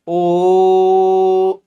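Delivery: ओ held steady and loud; drawn-out ओ; long held o. Shown as a demonstration. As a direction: sustain the ओ like a chant. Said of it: "लंबा भी बोल लेते हैं ना".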